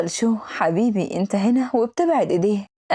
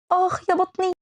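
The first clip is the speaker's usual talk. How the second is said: a high pained "آخ", much higher in pitch; cry of pain; 9 semitones higher than usual. Acted. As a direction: clutch the belly and wail. Faking a stomach pain. (صرخة ألم مصطنعة)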